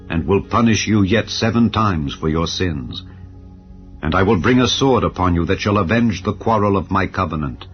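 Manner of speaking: ordinary delivery, nothing marked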